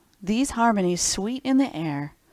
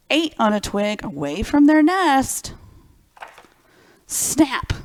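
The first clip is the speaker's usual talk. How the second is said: dramatic read-aloud; 3 semitones higher, 4 dB louder than usual. Acted. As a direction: ham it up for children. Dramatic rhyming story reading.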